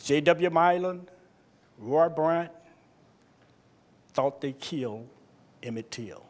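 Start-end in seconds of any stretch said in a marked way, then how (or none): none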